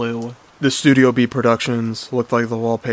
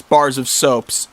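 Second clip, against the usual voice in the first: ordinary delivery, nothing marked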